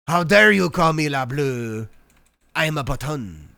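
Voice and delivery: a fake accent, playing mock insulted; mock indignant accent. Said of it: "That's my accent".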